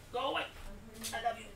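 very high, soft cooing. Real, not acted. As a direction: coo to the dog as it climbs up. Talking to the dog.

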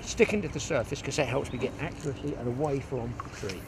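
normal talking voice

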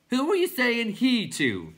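high, loud, drawn-out clown question; called down. A narrator's reading. Clown calling down to Bastian, high drawn-out yell. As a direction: holler down from the tower. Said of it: "called down to Bastian".

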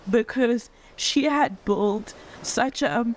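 fighting back tears mid-sentence, then apologizing; getting emotional. Real, not acted. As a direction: voice choking up with real tears, pitch lifting. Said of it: "I'm getting so emotional".

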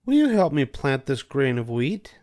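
a hen politely asking a neighbour; Little Red Hen. A narrator's reading. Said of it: "She asked the duck".

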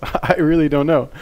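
laughing disbelief. Real, not acted. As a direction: laugh through the words. Laughing at the video.

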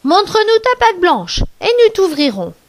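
high, lightly acted call of the eldest kid; mild storybook shout; crying out. A narrator's reading. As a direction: call it out high, falling towards the end. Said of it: "cria très fort".

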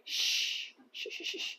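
long shush, then a quick string; unvoiced "shh" repeated rapidly. Real, not acted. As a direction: hush a noisy dog. Hushing the barking other dog.

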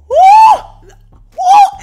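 high, loud laughing woo, drawn out slowly; ecstatic whoop. Retold or imitated. Reliving his hair whips, a high loud whoop. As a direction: let out a wild shriek.

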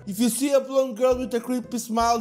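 ordinary talk, nothing marked

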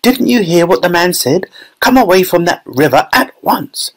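high-pitched scolding mother; high, urgent maternal scolding; Missus Gloop. A narrator's reading. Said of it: "called out Missus Gloop".

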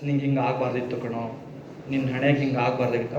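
low, soft, lightly tearful lament; a hint of crying family mourning his lost leg; mock weeping relatives. Retold family grieving at the hospital bed, softly tearful. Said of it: "ಅವರೆಲ್ಲ ಅಳ್ತಿದ್ರು".

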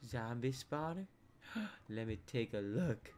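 usual speaking voice

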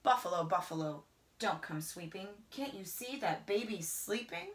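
usual speaking voice

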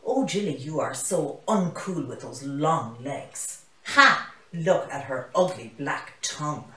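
loud jeering mockery with a cackle; taunting bullies, loud and cruel; mean taunting animals. A narrator's reading. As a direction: sneer at her loudly like a playground bully. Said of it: "say mean things about her".

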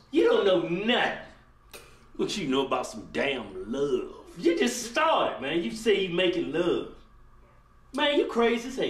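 loud scornful teasing; mock him loudly for his boast; loud mockery. Mocking a young man who says he made love, loud and scornful.

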